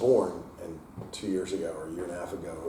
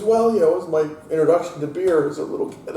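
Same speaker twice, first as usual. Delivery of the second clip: son's cheeky excuse; 10 semitones higher, 9 dB louder than usual. Retold or imitated. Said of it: "he goes".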